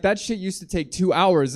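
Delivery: ordinary talk, nothing marked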